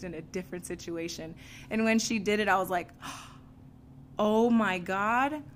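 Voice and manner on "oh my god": very slow, louder oh my God; drawn out in dawning shock; stunned realization. Retold or imitated. Recalling seeing herself in the new girl, stunned. Sudden realization in group session.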